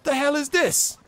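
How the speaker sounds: high-pitched, baffled goblin boss; goblin boss, indignant. Acted. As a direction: demand it as a put-out goblin chief.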